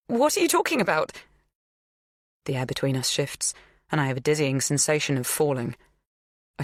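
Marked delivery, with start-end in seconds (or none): 0.1-1.1 alarmed, pitched far up; much higher voice. A narrator's reading. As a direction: squeak it out in alarm. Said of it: "My voice is an octave higher than usual".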